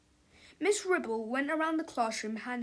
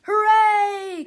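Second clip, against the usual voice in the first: loud, high, jubilant cry; one long gleeful whoop; children's cheer; 10 semitones higher, 12 dB louder than usual. A narrator's reading. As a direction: cheer like a class of kids. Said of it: "cried the children".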